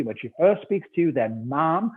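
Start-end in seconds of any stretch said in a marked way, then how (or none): 1.5-1.9 "Ma'am" said with a short flat a, rhyming with jam. Shown as a demonstration. Explaining palace protocol to the interviewer, "Ma'am" rhymed with jam. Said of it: "to rhyme with jam".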